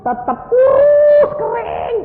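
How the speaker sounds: very high, drawn-out, loud; exaggerated squeal. Preacher exaggerates how skinny he stayed, squealing high and slow. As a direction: stretch it into a hammed-up squeal.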